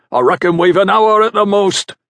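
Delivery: carrying foreman's shout, pitched high. A narrator's reading. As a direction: shout the deadline to the workers. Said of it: "shouted Bullymore".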